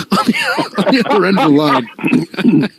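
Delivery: real laughter breaking through the words; laughing. Real, not acted. Cracks up telling the story.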